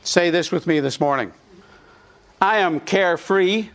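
usual speaking voice

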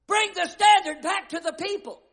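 high, loud hollered command. Retold or imitated. Commander hollering after his runaway flag bearer. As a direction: call him back at full lung.

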